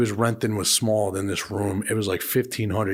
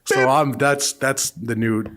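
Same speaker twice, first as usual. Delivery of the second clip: normal talking voice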